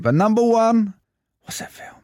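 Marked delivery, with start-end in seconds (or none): 1.4-1.9 whispered question. Acted. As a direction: breathe the question softly. Quiz clue whispered.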